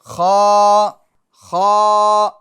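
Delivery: خا said loud and full-mouthed; full heavy khaa. Shown as a demonstration. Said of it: "پور بھی کرنا ہے اس کو". (خا کو پُر اور موٹا پڑھیں)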